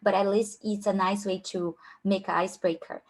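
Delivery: ordinary talk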